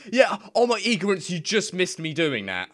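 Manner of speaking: high, loud excited burst; bursting out giddy. Real, not acted. Excited outburst after laughing.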